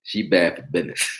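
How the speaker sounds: ordinary talk, nothing marked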